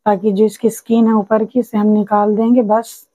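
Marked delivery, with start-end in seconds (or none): none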